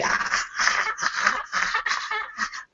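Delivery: very high shrieking cackle; evil cackle. Acted. GM's undead skull ends its threat, shrieking evil cackle. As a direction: scream a maniacal laugh.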